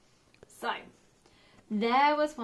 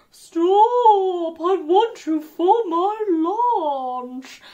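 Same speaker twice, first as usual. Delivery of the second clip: loud, higher, slow mooing voice; mooing cow; 6 semitones higher, 12 dB louder, much slower than usual. A narrator's reading. Cow mooing at the passing gingerbread man, loud and slow. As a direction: moo the words out like a cow.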